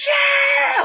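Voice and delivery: sudden high yell of joy; imitating a friend cheering at the screen; mock excited shout. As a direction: yell it like a fan losing it. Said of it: "he starts freaking out there".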